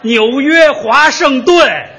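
"纽约华盛顿" said very slowly with every syllable clear; slow correction. Shown as a demonstration. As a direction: spell out "纽约华盛顿" slowly, laughing. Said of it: "花生豆了又". (笑着一字一顿纠正)

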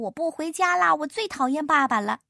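mock wailing, pitched high; 哇哇大哭. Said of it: "哇哇大哭". (模仿小猪哭闹，音调提高)

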